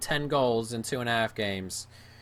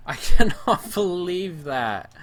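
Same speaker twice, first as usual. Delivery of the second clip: higher, louder laughing disbelief; exasperated laughing; 8 semitones higher, 7 dB louder than usual. Real, not acted. Gamer laughing at his awful season, exasperated laugh. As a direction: laugh through the frustration.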